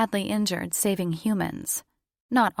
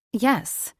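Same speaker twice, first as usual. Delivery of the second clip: defiant; 6 semitones higher than usual. A narrator's reading. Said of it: "She turned up her chin".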